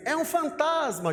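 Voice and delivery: mock frightened cry, high and drawn out; Pedro. Preacher playing the disciple who sees a ghost, crying out. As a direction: cry out in pretend alarm, stretched and high. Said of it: "Pedro grita assim".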